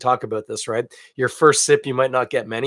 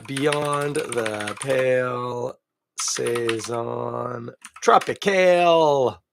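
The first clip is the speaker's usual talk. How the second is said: slow name read; much slower than usual. Acted. Reading the product name.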